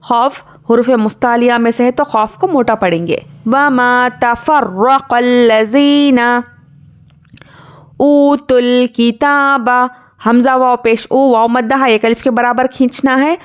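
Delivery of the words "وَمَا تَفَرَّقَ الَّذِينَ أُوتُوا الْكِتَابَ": slow, melodic tajweed recitation; measured Quranic chant. Shown as a demonstration. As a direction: chant it slowly like a qari. Teacher recites Quran verse.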